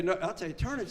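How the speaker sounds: ordinary talk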